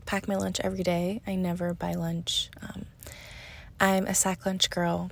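normal talking voice

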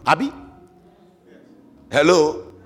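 ordinary talk

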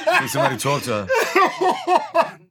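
high, slow line through laughter; laughing aside. Real, not acted. Joking that someone talked to Norma, laughing. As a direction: say it while cracking up.

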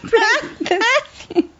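laughing. Real, not acted. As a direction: crack up while telling it. Cracking up telling the story.

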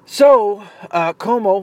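ordinary talk, nothing marked

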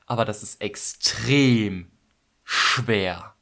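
slow, lightly voiced teacher warning; stern guitar teacher. Guitar teacher warning his pupil, slowly. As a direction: drag out extrem like a teacher who means it.

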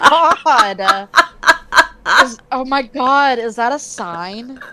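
sputtering after swallowing a bug; just swallowed a bug. Real, not acted. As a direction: talk right after a bug goes down. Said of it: "I think I just swallowed a bug".

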